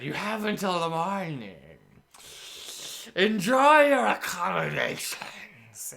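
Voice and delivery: high, slow, mocking hag voice; sea hag farewell. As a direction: savor the threat sweetly. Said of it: "and said".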